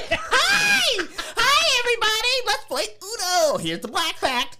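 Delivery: hammed-up falsetto hello to everyone; chirpy meeting voice. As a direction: squeal it like an overeager coworker. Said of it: "I'm gonna talk to my coworkers in a meeting" voice".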